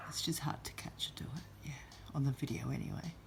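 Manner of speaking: hushed whisper to the viewers. Real, not acted. Owner filming her chatty parrot, whispering. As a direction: whisper so the bird keeps talking.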